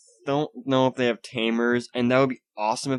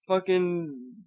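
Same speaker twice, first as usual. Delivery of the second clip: laughing at himself; 8 semitones higher, 5 dB quieter than usual. Real, not acted. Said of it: "I'm sorry, let me try that again".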